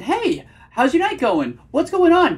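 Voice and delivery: high, loud, over-eager mock greeting; nervous tonality. Shown as a demonstration. Coach demonstrates the unattractive way to greet a date, nervously high. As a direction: push the pitch way up, nervously eager. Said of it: "you can just hear the nervousness".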